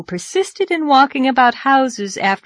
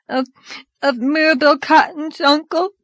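put-on high, stammering child's sob; tearful sobbing; 8 semitones higher than usual. A narrator's reading. Dora admitting what frightened her, sobbing. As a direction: get the answer out haltingly, as a scared crying girl. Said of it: "sobbed Dora".

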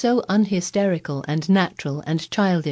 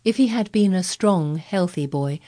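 ordinary delivery

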